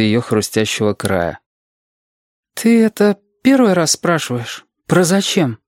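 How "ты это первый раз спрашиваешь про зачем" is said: put-on high child voice; questioning child. Reshka questioning Alexander from under the sheet, high child voice.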